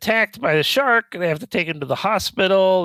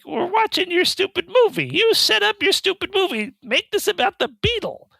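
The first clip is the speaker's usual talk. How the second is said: much higher, fed-up rant at the filmmakers; pleading with the movie to just stay on topic; exasperated viewer; 11 semitones higher than usual. Retold or imitated. Frustrated viewer voiced, you're like.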